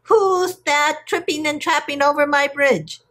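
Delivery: high-pitched troll challenge, not fully shouted; shouting troll. A narrator's reading. Smelly troll challenges middle goat, high mild shout. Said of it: "the smelly troll shouted".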